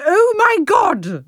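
high, drawn-out mock shock; melodramatic exclamation. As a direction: announce it like a cliffhanger title. Reads out melodramatic chapter title.

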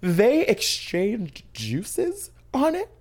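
high, slow, loud shocked disbelief; hammed-up outrage, drawing each word out; mock horror. Cohost reacts to a listener's mattress confession, scandalized. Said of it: "That was a Broadway performance".

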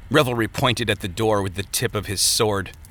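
ordinary delivery

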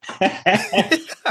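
high, airy laugh; laughing with excitement. Real, not acted. Host laughs after "I'm ready.

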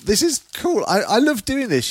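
high, delighted voice; thrilled while unwrapping; excited. Real, not acted. Unwrapping a present.

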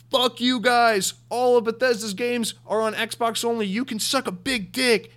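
high, loud stage shout, drawn out; mock triumphant yell. Phil Spencer taunts Sony from the stage, mock yell. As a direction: holler it like a defiant executive announcing victory. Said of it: "he yells so loud".

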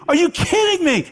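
high, outraged yell of disbelief. Retold or imitated. Fan shouting at the umpire on TV, outraged high yell. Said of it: "I'm yelling at the T V".

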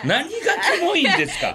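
laughing protest. Real, not acted. Mock-offended retort, laughing.

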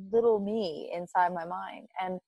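normal talking voice

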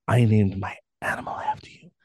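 hushed, breathy creepy confession; creepy whisper. Retold or imitated. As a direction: whisper it like an unsettling admirer. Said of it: "it was like".

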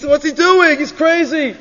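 high-pitched, incredulous scoff; mocking brothers. Brothers watching David face Goliath, scornful disbelief.

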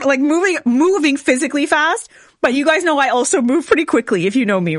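ordinary talk

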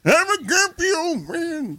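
put-on high, drawn-out grumpy old man caricature; grumpy old man impression. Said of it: "Will sits out there and goes".

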